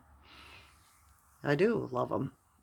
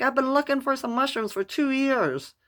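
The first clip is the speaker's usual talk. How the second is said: excited; 12 semitones higher, 7 dB louder than usual. Retold or imitated. Excited, retelling her eager plea.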